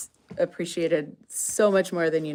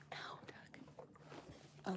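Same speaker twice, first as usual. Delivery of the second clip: unvoiced whispered aside; whispering; 22 dB quieter than usual. Real, not acted. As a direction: whisper it so Doug won't hear. Aside near animals.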